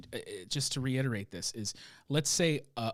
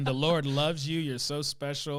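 louder, higher mock flattery; sarcastic; 3 semitones higher, 5 dB louder than usual. Prophet flatters Ahab, hammed-up sarcastic praise. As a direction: lay on the fake praise. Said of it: "You just picture him like".

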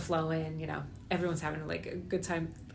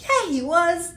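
mock insistence; 11 semitones higher, 9 dB louder, slower than usual. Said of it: "I'm like".

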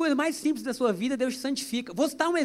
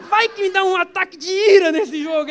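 mock angry outburst; 6 semitones higher, 7 dB louder than usual. Joking about fit of rage.

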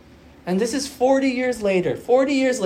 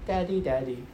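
imitating little daughter; 4 semitones lower, 9 dB quieter than usual. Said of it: "coming from her cute voice".

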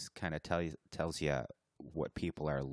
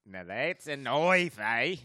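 higher, louder slow Aussie quote; mock Australian accent; 8 semitones higher, 7 dB louder, slower than usual. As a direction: ham up the famous knife line. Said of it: "with our accents".

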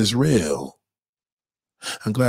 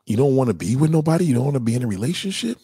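rushed question; much faster than usual. Acted. Man probing his date.

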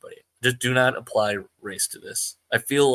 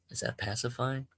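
normal talking voice

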